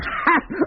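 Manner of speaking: short high scornful laugh; mocking laugh. Designer laughing at the captain's arrest threat, short and scornful.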